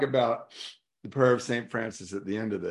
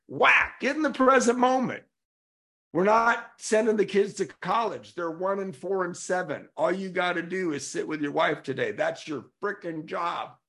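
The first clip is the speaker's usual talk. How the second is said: high, punchy reprimand easing toward the end; scolding God; 7 semitones higher than usual. Acted. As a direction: play an exasperated God laying down orders. Acts out God snapping him back.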